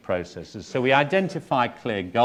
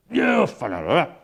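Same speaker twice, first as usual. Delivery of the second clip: imitated outburst, loud and high; mock a patient's garbled angry yell; angry shouting; 8 semitones higher, 5 dB louder than usual. Patient shouting at therapist in group, imitated angry shout. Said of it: "he starts shouting at me".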